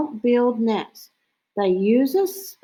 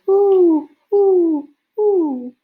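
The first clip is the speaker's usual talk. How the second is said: high, loud hooting that fades; great horned owl hoot; 9 semitones higher, 6 dB louder, much slower than usual. Retold or imitated. Showing kids the great horned owl call, high hoots. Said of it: "And the great horned owl goes".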